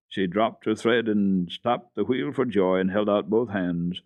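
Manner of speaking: ordinary delivery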